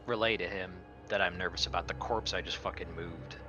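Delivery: ordinary talk, nothing marked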